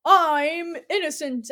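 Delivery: loud, slow protest; mob boss plea. Retold or imitated. Mob boss protests to the hero, loud and slow. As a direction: plead innocence loudly and slowly.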